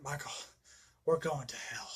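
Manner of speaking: hushed, voiceless last words; grim whisper. Retold or imitated. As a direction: whisper it like a doomed final vow. Loomis dying threat to Michael.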